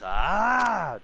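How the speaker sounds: high loud battle yell; Viking scream. Retold or imitated. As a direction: roar it out like a Viking warrior. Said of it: "I'm screaming like a Viking".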